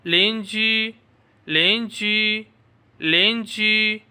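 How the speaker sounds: लेंजी said slowly as Chinese línjū; slow drilled लेंजी for the class; repeat after me. Shown as a demonstration. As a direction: model लेंजी, slow and clear. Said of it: "मेरे साथ पढ़ते जाएं".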